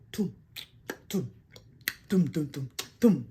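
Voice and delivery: short, spaced low thumps; mouth-made intro beat. Shown as a demonstration. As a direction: voice a boxing walk-in beat. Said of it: "So, hear my voice go".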